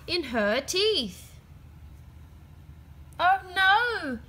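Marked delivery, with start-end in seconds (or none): 3.2-4.2 high, loud dismayed cry; alarmed cry. A narrator's reading. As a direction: gasp out the worry. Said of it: "she cried".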